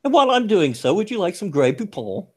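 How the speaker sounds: high mock posh Englishman; prissy upper-crust British voice; posh British accent.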